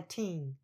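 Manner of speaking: put-on teen drawn out with a falling tune; stressed TEEN. Shown as a demonstration. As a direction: give teen full, long emphasis. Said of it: "要把重音放在teen". (teen 念重、拉长)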